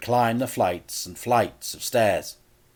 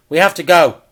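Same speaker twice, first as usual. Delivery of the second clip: louder, higher urging; urgent plea; 8 semitones higher, 14 dB louder than usual. Acted. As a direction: pull Dad away now. Frank urging his father.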